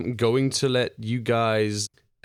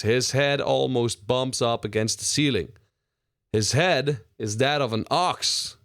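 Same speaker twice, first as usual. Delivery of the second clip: normal talking voice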